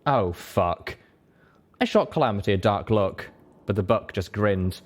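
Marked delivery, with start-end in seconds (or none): none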